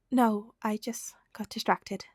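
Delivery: plain reading voice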